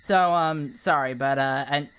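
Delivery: ordinary talk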